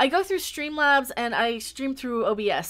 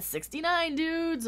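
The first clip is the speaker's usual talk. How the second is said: lightly imitated movie catchphrase, higher pitched; Bill and Ted; 6 semitones higher, slower than usual. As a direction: quote the dudes, pitched up a little. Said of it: "the Bill and Ted".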